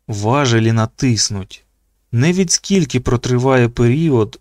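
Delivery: usual speaking voice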